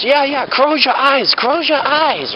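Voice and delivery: a high, drawn-out impression of the laughing lady; imitated salon lady. Salon lady laughing at the mix-up, high slow mock voice.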